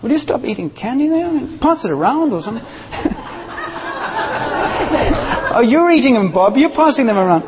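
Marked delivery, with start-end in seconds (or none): 2.9-5.5 high amused laugh. Real, not acted. Preacher laughing at a candy eater in the pews. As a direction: burst out laughing.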